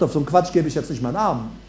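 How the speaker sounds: low, soft and fast, brushing it off; a quick, dry refusal; dismissive aside. Pretend. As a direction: mutter it off quickly. Jesus dismisses nonsense.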